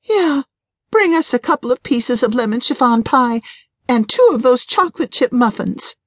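high-pitched, worked-up wife's voice; wife ordering food. A narrator's reading. Wife orders pie and muffins from the waitress, high-voiced.

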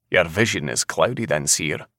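ordinary delivery, nothing marked

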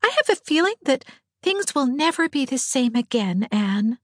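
put-on high, mournful girlish foreboding; sad. A narrator's reading. Diana confiding to Anne that things will change, sadly. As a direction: voice the worry high and mildly sorrowful. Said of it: "said Diana sadly".

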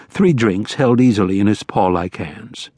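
ordinary delivery

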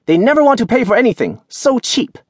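high, loud, scornful market woman; indignant old vendor. A narrator's reading. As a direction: complain loudly like an old vendor. Fruit seller complains angrily.

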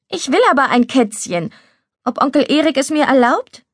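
lightly voiced little girl pushing for a yes; small girl, ending on a hopeful question; young girl, wanting.

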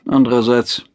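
usual speaking voice

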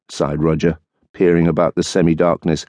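usual speaking voice

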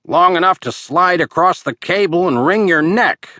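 dangling hero crying out in defiance; angry cry. Acted. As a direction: fire it back at the villain. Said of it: "Murphy cried".

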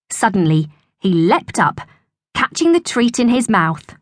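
plain reading voice

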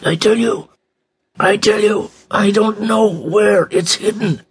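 very high, thin strained voice; rasping, strained. A narrator's reading. Buried man protesting to whoever is above, rasping and strained. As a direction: squeeze it out high, as if barely able to speak. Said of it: "a voice that was so rasping and strained".

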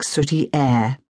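ordinary delivery, nothing marked

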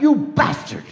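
mock yelp at the dog. Bitten by dog, cries out.

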